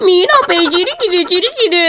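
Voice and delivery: slow cartoonish wail, pitch leaping up; scared crying. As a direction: bawl in hammed-up terror of a ghost. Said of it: "எதுக்கு இப்படி வந்து அழுதுகிட்டு இருக்க". (பயத்தில் அழுது புலம்பும் குரல்)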